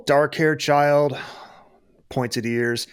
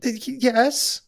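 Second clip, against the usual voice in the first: high, slow captive retort; elf mother; 8 semitones higher, slower than usual. Acted. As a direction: answer back much higher.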